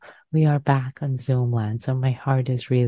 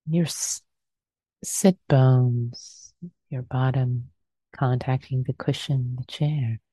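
guided meditation. Acted. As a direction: ease them gently into stillness.

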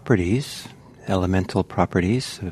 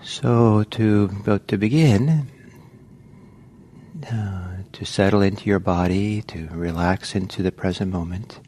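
guided meditation. Acted. Teacher guiding the group into the sitting, calm meditation voice. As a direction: settle them into the body gently.

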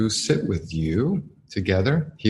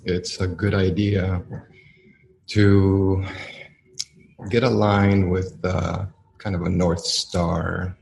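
guided meditation. Acted. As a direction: ease the listeners into stillness, one phrase at a time.

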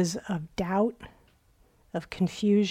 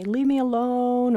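higher, held-out complaint; mock reactive whine; 5 semitones higher than usual. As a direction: protest like someone stung, hammed-up. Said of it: "he's not reactive. He doesn't say".

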